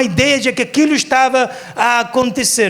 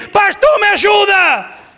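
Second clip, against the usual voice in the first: high, loud desperate yell; shouting for help; 9 semitones higher, 4 dB louder, slower than usual. Pretend. Preacher imagines bursting into pastor Paulo's bedroom, shouting for help. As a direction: scream to wake a sleeper for help. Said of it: "a gritar para ele".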